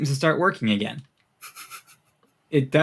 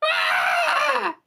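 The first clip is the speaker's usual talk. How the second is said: high piercing monster shriek; shrill screech from the stairwell; shrill creature scream. A narrator's reading. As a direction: let out a shrill inhuman cry. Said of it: "in this sort of shrill voice going".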